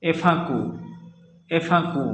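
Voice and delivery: एफाकू opening on a nasal ए; French example pronunciation. Shown as a demonstration. Teacher modelling the phrase twice for learners, nasal ए in एफाकू. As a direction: say एफाकू with its first vowel through the nose. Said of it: "इसके example समझते हैं".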